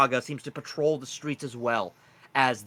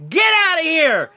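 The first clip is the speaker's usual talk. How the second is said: loud, much higher yell; excited sign-off shout; 11 dB louder than usual. Real, not acted. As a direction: send them off with a big shout. Excited outro to viewers.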